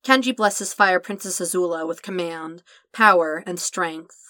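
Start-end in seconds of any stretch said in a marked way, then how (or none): none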